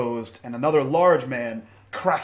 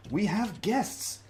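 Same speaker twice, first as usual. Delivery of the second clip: smooth NPC voice; 8 semitones higher than usual. Acted. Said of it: "walks over and says".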